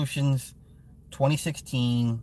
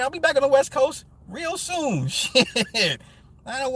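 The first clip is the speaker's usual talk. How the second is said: ordinary delivery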